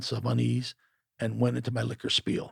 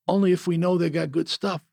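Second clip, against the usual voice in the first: put-on higher, louder Jamaican accent with a smile; smiling Jamaican; 5 semitones higher, 5 dB louder than usual. A narrator's reading. Jamaican customer answering the seller, smiling, light accent. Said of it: "the Jamaican smiled".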